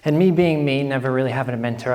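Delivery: normal talking voice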